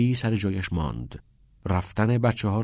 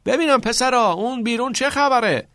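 high-pitched shout from a distance; loud, high questioning holler; 14 semitones higher, 5 dB louder than usual. A narrator's reading. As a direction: call out to people outside. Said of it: "گلوریا از توی غار داد زد".

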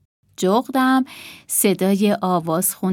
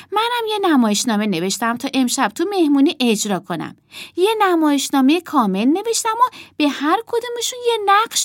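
put-on higher, lively child's voice; earnest, indignant girl; 7 semitones higher, 4 dB louder than usual. A narrator's reading. Emma explains her play and missing puppets, indignant. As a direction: tell it urgently as an upset child.

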